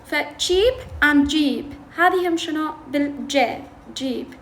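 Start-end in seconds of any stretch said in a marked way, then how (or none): 0.1-2.0 cheap and jeep drawn out very slowly, each word separate; contrasting sounds. Shown as a demonstration. Teacher contrasting ch and j for students, very slow. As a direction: stretch cheap and jeep to show ch against j. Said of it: "ترسم بالرسم الصوتي تشي".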